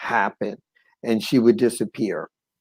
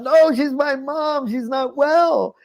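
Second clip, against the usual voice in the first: high, drawn-out desperate call; crying out; 13 semitones higher, slower than usual. Retold or imitated. Son calling to passerby about his mom, high cried-out plea. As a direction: re-enact a pleading holler, stretched out high. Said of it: "And I cried out".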